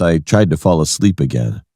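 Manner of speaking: ordinary delivery, nothing marked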